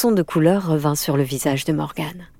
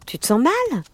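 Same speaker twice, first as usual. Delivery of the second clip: higher-pitched, slightly worried question; mild concern; 9 semitones higher than usual. A narrator's reading. Friend sits by the girl's bed, mildly worried. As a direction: check anxiously on a friend. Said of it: "avec quelque inquiétude".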